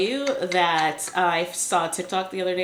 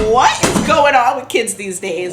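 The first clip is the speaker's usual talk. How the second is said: put-on loud, high old-timer complaint; cranky old person; 5 semitones higher, 9 dB louder than usual. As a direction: grumble about kids today. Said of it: "I'm always like".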